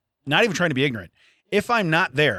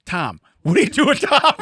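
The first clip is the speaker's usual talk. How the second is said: startled shout; 8 semitones higher, 6 dB louder than usual. Retold or imitated. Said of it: "If he would've turned you in".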